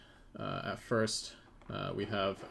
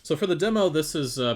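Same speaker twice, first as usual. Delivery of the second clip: loud; 7 semitones higher, 12 dB louder than usual. Real, not acted. Sudden loud restart.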